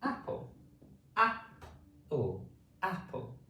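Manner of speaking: apple said slowly with a clean open a; clear apple. Shown as a demonstration. Teacher repeats the example word for children, careful apple. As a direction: say apple clearly, opening the mouth. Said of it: "A word with ah is".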